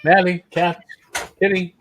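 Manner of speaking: high, sing-song call to a pet; talking to a dog. Real, not acted. Calling the dog to look.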